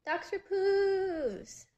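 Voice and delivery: long, high, loud drawn-out call of the name; hollered greeting, slow and loud. Acted. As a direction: stretch it out high and loud, like calling across a room. Calling out a name.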